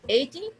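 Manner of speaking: Eighty with stress on EIGH; Eighty, punched front and light ty; stress on first syllable. Shown as a demonstration. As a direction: say Eighty as EIGH-ty, short ending. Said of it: "second syllable पे".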